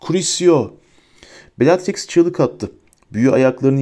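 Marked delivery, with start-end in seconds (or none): 0.1-0.7 high, contained yell; cried-out spell. A narrator's reading. Harry leaps out to curse Bellatrix, crying out. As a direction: hurl the spell out in a raised voice. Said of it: "Fıskiyenin arkasından fırladı ve haykırdı".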